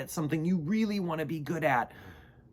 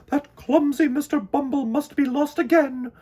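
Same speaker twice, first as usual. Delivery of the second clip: higher, louder exasperated queen voice; sighing queen; 13 semitones higher, 8 dB louder than usual. Acted. Queen bee sighing about clumsy Mister Bumble lost again. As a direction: fret over him like a weary queen. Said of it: "she sighed".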